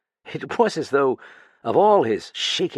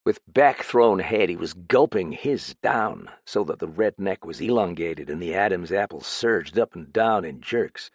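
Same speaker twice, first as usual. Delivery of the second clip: ordinary delivery, nothing marked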